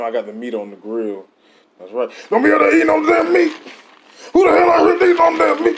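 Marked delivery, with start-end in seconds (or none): 2.3-5.8 high, fast, hammed-up yelling; shouting. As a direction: bark at them like a crazed dad at the grill. Mock grill-dad yelling at guests.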